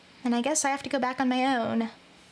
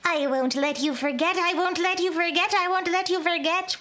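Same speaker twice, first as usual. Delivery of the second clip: evil witch; 4 semitones higher than usual. Acted. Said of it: "an evil witch kind of situation".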